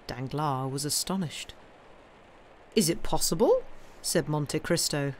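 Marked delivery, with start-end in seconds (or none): 2.7-3.6 mild mock surprise, rising; feigned astonishment. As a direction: pretend to be amazed, lightly. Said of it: "feigning astonishment".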